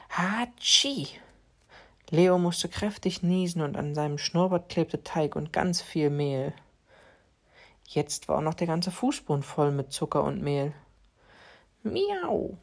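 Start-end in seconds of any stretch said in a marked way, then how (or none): 0.1-1.1 mock sneeze. Cat sneezes. 11.8-12.6 a squeaky high mock meow, falling; high cat meow. Cat meows.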